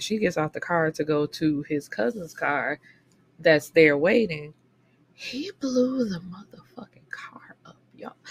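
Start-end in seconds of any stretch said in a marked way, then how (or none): 5.1-8.2 hushed, stunned whisper of the twist; shocked whisper. Real, not acted. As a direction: whisper it like unbelievable gossip. Shocked reveal to viewers.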